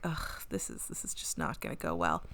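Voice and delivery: low, hushed groan of foreboding; dismayed aside. Retold or imitated. Podcaster reacting to the creepy bug box, dismayed aside. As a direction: mutter it low and husky, dropping away.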